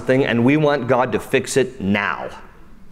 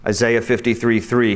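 ordinary talk, nothing marked

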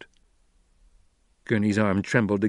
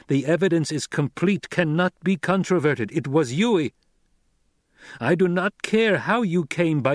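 high, emphatic warning; stern young lord; 6 semitones higher, 4 dB louder than usual. Acted. Son defending his mother to her captor, stern and insistent. Narrator voices Paul warning Gurney.

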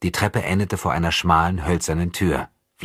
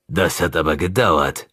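a touch of mock reproach, louder; frowning; 4 dB louder than usual. A narrator's reading. Tepesch greeting Andrei from his bed, mildly reproachful. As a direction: chide him lightly, unsurprised. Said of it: "sagte er stirnrunzelnd".

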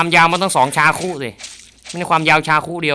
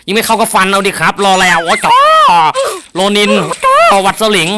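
higher and louder, urgent; frantic outburst; 6 semitones higher, 6 dB louder than usual. Real, not acted. Gamer panicking when bullets don't hurt zombies, loud. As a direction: panic as shots fail.

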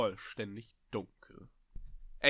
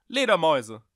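loud call, pitch falling at the end; loud, insistent repeated call; calling again; 5 semitones higher, 10 dB louder than usual. Acted. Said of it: "rief er noch einmal".

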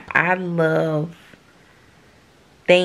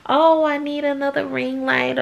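lighter, higher offhand request; breezy higher voice, asking her husband for gear; casual ask; 8 semitones higher than usual. Retold or imitated. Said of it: "I could say".

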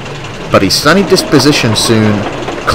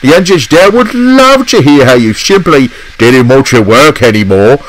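much higher, louder furious scolding; angry outburst; 9 semitones higher, 4 dB louder than usual. Acted. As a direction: let him have it, fed up at last. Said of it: "snapped Boko".

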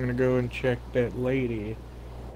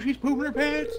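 much higher gleeful squeal; excited; 13 semitones higher than usual. Real, not acted. Gleeful game commentary.

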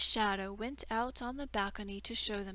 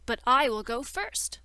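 sharp loud retort; 2 semitones higher, 10 dB louder than usual. Acted. Shadow cuts off the learned man.

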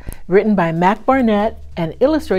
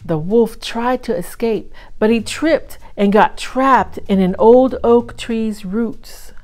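ordinary delivery, nothing marked

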